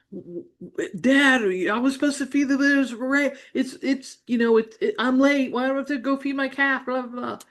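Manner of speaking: put-on high, frantic, stammering kid protesting to Dad; a flustered little girl pleading, words tripping over each other; upset child. Young daughter panicking to Dad about feeding her calf late, upset and high-pitched. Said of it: "I'm upset now".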